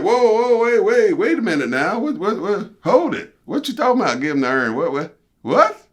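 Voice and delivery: high, loud flustered protest; a high stammering wait-what; stunned outrage. Retold or imitated. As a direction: told to give away the urn, a loud flustered protest.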